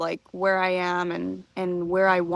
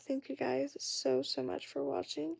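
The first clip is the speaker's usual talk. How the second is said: whispering; 6 semitones higher, 10 dB quieter than usual. Real, not acted. Whispered goodbye at bedtime.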